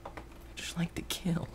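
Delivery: soft, voiceless mock-psycho whisper; creepy whisper. Gamer answering yelling viewers, mock-creepy whisper. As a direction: drop to a sinister whisper.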